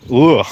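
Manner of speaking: disgusted retching ugh; gagging on a fly. Real, not acted. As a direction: choke out a gag. That was definitely swallowed a fly.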